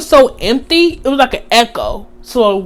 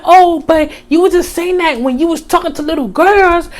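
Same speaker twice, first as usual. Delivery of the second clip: retold accusation; 6 semitones higher than usual. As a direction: throw it in his face, raised voice. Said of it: "I was like".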